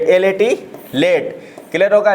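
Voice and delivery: normal talking voice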